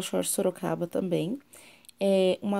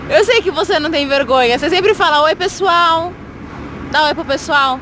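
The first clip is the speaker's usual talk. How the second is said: loud coaxing; 7 semitones higher, 9 dB louder than usual. Real, not acted. Mother urging daughter to greet the viewers, loud and high. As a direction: push her to say hi, loud and high.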